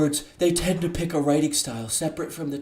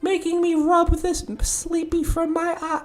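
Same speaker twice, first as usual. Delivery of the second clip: sensual Sayori voice. Acted. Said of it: "really sensual reading in Sayori's voice".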